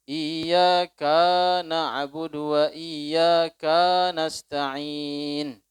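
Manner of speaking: "iyyaka" stretched very slowly and loud, the kaf clearly split off; lengthened kaf. Shown as a demonstration. Preacher shows the congregation the lengthened kaf, very slow. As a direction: chant "iyyaka na'budu" slowly with a long held kaf. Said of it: "kadang dipanjangin".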